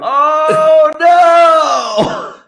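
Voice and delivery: high, loud cry of fake dismay; mock horrified shout. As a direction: howl it out in mock horror. Mock horror again.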